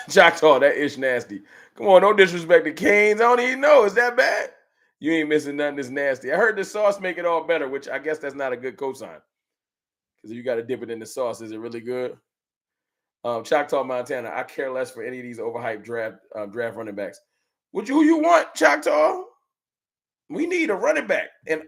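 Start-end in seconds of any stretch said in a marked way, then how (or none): 1.8-4.5 excited protest. Real, not acted. Defends Canes, reacting to chat. 17.7-21.3 excited call-out. Real, not acted. Calls out to a chatter.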